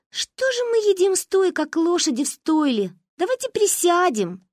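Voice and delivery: put-on bright high-pitched girl; high little girl voice. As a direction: speak like an eager little girl.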